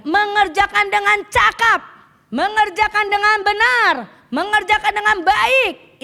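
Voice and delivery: loud, high, rousing sermon voice, slowing on the list; preaching at full force. Acted. Preacher rousing the congregation, loud and high.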